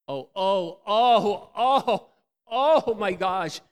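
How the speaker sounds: loud, high, slow exasperated groan; rising anger. Retold or imitated. Husband boiling over at his wife's taunt, loud and high. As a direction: boil over.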